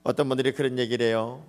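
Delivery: usual speaking voice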